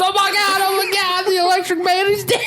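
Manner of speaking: high hammed-up panicked wail, loud; mock panic. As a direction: play the customer shrieking into the phone. Voicing panicked customer calling cops.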